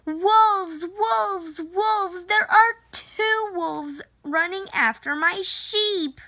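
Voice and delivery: high, urgent cry of wolf; yelling for help. Acted. Shepherd boy yelling to the town for help, high and urgent. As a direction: shout it like a boy raising the alarm. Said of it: "this time yelling".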